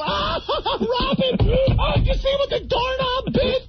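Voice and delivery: very high, helpless mock guffawing; rolling-laughing audience. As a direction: squeal through exaggerated fan laughter. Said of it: "And everyone's roll".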